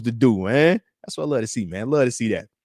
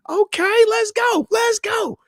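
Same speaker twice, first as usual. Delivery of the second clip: excited shout; much slower than usual. Real, not acted. Streamer cheering a viewer's comment, high excited shout. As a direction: cheer it like a touchdown.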